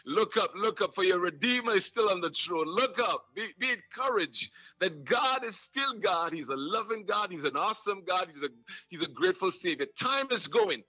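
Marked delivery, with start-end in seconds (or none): 0.0-8.3 rising, fervent sermon cadence; preaching. Acted. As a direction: preach it to the congregation with fire. Preaching at full force.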